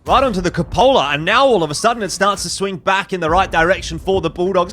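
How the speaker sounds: loud, high play-by-play rush; excited esports caster. Real, not acted. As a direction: shout the big play as it lands. Caster reacts to big shot.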